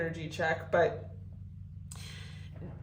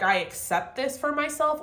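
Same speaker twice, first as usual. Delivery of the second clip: higher, light I accept this for myself; bright acceptance; 7 semitones higher than usual. Pretend. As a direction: lift it up, accepting. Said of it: "she's either like".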